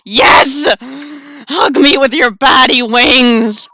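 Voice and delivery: loud, slow gushing cry; hammed-up glee; excited shout. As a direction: gush it out.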